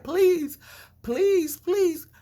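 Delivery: high, loud pleading please; begging owner. Retold or imitated. Store owner begging protesters, high loud pleading. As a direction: imitate the owner begging them to stop.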